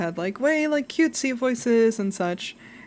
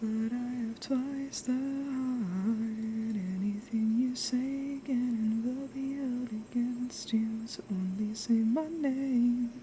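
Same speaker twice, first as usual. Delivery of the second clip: soft, slow onee-sama whisper; One-sama voice; 3 semitones higher, 9 dB quieter, slower than usual. Pretend. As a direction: tease slowly like a cool older sister. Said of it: "One-sama voice".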